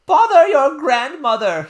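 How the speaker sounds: very high, very loud exasperated outburst; exasperated nurse. Acted. Nurse losing patience with the princess, loud outburst. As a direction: blurt it out fed up.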